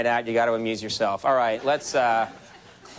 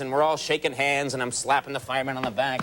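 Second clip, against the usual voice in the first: normal talking voice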